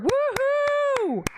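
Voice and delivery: high whooping woohoo; a real joyful woohoo; happy cheer. Real, not acted. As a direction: whoop with delight. Celebrating the clipper purchase.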